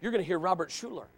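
ordinary delivery

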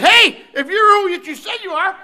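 high, loud, scornful taunt of the thief; mocking thief. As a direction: jeer at Jesus like the unrepentant thief.

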